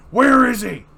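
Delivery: high, loud angry demand; furious shout; furious roar. Acted. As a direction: explode with the question. Batman interrogating furiously.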